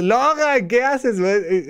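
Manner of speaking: chirpy doggy talk to his pet Logan; playful high voice for the dog. Shown as a demonstration. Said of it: "No le hablo a mi perrito como hablo en el podcast".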